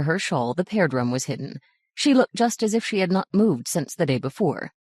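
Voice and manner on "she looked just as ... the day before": ordinary delivery, nothing marked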